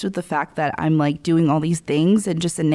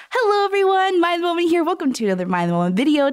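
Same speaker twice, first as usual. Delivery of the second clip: much higher, bright channel intro; video host voice; 8 semitones higher, faster than usual. Shown as a demonstration. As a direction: welcome viewers like a cheerful host. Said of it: "And then when I'm doing a video".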